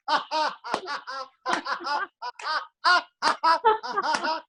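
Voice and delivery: high, long happy laugh. Shown as a demonstration. Teacher laughing on and on for the class. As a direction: let it roll on. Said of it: "I'm so happy".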